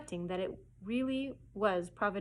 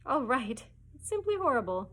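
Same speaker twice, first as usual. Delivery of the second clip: higher, louder, appalled; loud disgust; 4 semitones higher, 5 dB louder than usual. Acted. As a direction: spit out the verdict in disgust. Marilla tastes awful cake.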